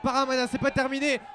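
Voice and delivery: shouted goal call; excited sports commentary. Real, not acted.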